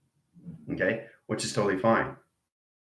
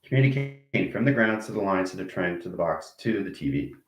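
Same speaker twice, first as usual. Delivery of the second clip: ordinary talk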